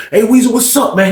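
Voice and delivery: high, confrontational greeting; somewhat aggressive. Retold or imitated. Cheated man confronting the storyteller, somewhat aggressive. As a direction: imitate a man stepping up with a challenge. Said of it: "He kinda came aggressive with it".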